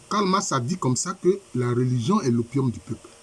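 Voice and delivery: usual speaking voice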